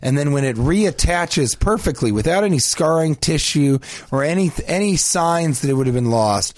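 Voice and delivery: normal talking voice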